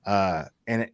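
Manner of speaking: ordinary delivery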